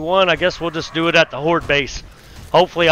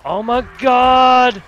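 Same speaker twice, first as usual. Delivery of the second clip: drawn-out startled cry, higher; real alarm at his own mistake; shocked; 7 semitones higher, much slower than usual. Real, not acted. Gamer locking himself in, startled and drawn out.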